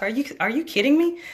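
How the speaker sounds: can't believe what you hear; incredulous. Retold or imitated. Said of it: "looking at this dude like".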